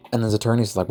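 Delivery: usual speaking voice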